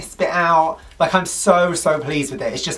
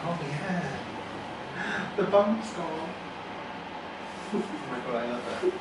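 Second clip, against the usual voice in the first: soft, real awe at the result; hushed delight; 12 dB quieter, slower than usual. Real, not acted. Client seeing the bump gone, hushed delight. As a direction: take it in quietly, pleased. Client first sees her new nose.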